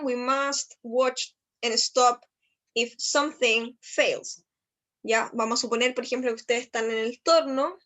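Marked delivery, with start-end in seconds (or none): 0.0-4.4 we must watch and stop, slow and even; slow model sentence. Shown as a demonstration. Teacher reading an English example slowly to class. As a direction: lay each word out for learners. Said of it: "teacher modelling the sentence".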